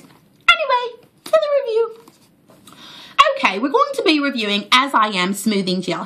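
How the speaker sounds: high, put-on British accent; playful mock-posh British voice. Vlogger hamming a British accent for viewers. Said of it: "enough British accent for today".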